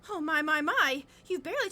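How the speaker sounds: the doting mother cries out over the plate; exclaiming mother. A narrator's reading. Mother exclaims to Claire at dinner, fussing. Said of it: "she exclaimed".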